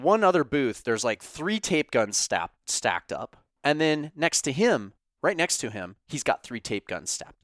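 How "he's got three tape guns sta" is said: normal talking voice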